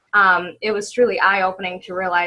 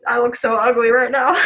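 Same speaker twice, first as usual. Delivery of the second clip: laughing through tears; 3 semitones higher, 4 dB louder than usual. Real, not acted. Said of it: "I'm gonna cry".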